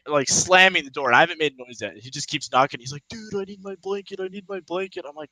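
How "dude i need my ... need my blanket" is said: high, soft put-on whine of a plea; thin, hushed mock begging, pitched up; pleading homeless man. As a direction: imitate a man begging through a door. Imitates homeless man begging at door.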